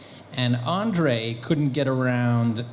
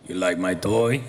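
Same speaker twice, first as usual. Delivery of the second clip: a touch lower, teasing; lightly voiced giant; 4 semitones lower than usual. Andre teasing the actor on the second day, lightly low. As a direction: tease him again like the giant. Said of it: "Second day".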